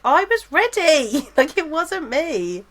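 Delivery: high, drawn-out mock-indignant whine; annoyed protest. Passenger retelling protest to husband after missed train, annoyed. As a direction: stretch it out in exasperated self-defence. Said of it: "I was so annoyed".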